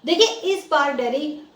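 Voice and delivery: usual speaking voice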